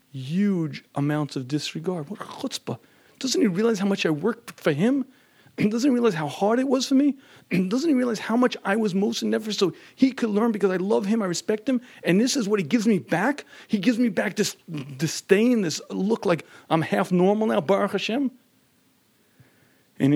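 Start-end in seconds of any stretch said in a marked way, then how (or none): 2.1-2.8 hurt whisper. Retold or imitated. Wife hurt by his remark. 3.2-18.3 higher, wounded and offended; hurt indignation. Retold or imitated. Wife stung by her husband's disregard, higher and wounded.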